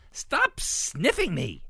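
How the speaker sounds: high, loud, drawn-out protest; indignant shout. A narrator's reading. Ruby tells the sniffing snouts off, shouting. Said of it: "Ruby shouted".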